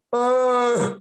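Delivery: high-pitched, repetitive mock nervousness; hammed-up awkward stammering; nervous small talk. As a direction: act casual while badly panicking inside. High son fakes sober small talk.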